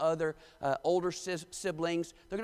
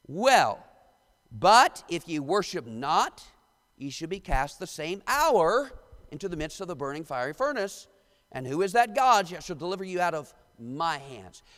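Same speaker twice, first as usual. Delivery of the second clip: dramatic scripture reading; 5 dB louder than usual. Acted. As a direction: proclaim the King James words like a preacher. Reads scripture aloud.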